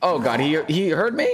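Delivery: high alarmed gasp; sudden fright, voice jumps up; panicked yelp. Real, not acted. Stalker heard him.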